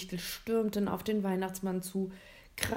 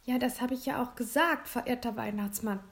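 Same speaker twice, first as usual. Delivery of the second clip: put-on slightly higher, polite boy's reply; mildly earnest politeness; 5 semitones higher than usual. A narrator's reading. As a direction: confirm it courteously to a respected elder. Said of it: "antwortete William höflich".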